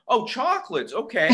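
usual speaking voice

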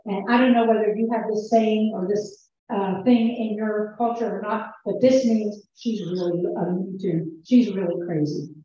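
ordinary talk, nothing marked

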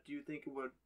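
ordinary delivery

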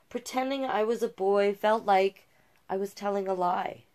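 bright, lively read-aloud listing hobbies; picture book narration. A narrator's reading. Reader shares Jazz's hobbies, lively storybook narration.

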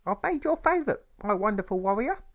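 very high, sing-song mother's voice; sweetly steer him away with dinner; mother deflecting. Acted. Mother deflects boy's question.

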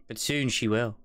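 ordinary talk

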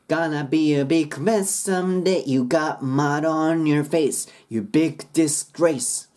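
slow rhythmic "Gonna be a big man someday" straight into "You got mud"; run-on lyric lines. Shown as a demonstration. Teacher showing how parts join, slow rhythmic linking. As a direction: move from "someday" directly into the next line. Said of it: "すぐここに移ることです".

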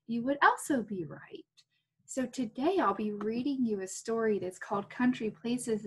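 ordinary talk

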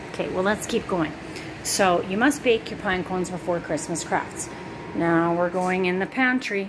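ordinary delivery

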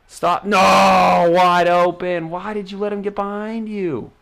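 loud, slow shout at the screen; frustrated yell. Real, not acted. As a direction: yell at the players in disbelief. Yelling at his defense.

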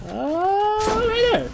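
very high excited yelp; excited squeal. Real, not acted. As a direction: squeak with sudden glee. Sudden kill opportunity.

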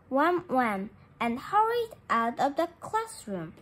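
ordinary delivery, nothing marked

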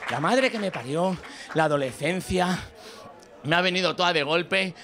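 ordinary delivery

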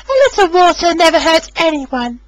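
high little engine, dismissive; scoffing Rosie. A narrator's reading. Rosie brushing off Emily, high and dismissive. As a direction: wave off the danger. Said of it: "scoffed Rosie".

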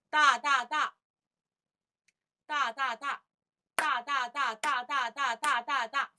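rolling, evenly spaced threes on 哒; even triplets. Shown as a demonstration. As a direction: chant each 哒 exactly as long as the next. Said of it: "非常的平均".

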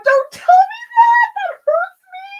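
very high, loud, crying shriek; anguished squeal. Real, not acted. Artist crying out at a fan's story, high anguished squeal. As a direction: cry out as if it physically hurts. Overwhelmed fan reaction.

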